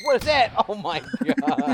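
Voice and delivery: high, loud cry breaking into laughter; shocked laughing outburst. Real, not acted. Player shocked by a sight in game, laughing yell. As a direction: blurt it out, then crack up.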